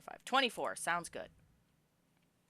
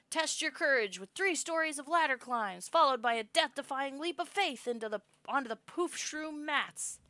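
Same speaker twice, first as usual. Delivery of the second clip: grand announcement; 8 semitones higher, 5 dB louder than usual. Acted. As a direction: unveil it like a showman. Announcer continues presenting tower.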